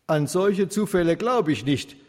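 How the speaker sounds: lauter und höher, trotzig; störrisch dagegenhalten; trotzig geknurrt. A narrator's reading. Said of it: "knurrte trotzig Herr Sauter". (Sauter widerspricht dem Pfarrer trotzig)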